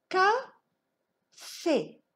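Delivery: café split into "Ca", pause, "fé"; syllable by syllable. Shown as a demonstration. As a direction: sound out "Café" in two separate beats, high. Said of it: "On va frapper les syllabes".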